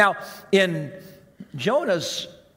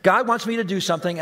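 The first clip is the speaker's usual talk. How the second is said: ordinary delivery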